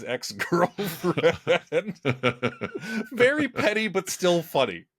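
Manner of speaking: laughing, high and loud. Real, not acted. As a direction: laugh through the words. Laughing at petty joke.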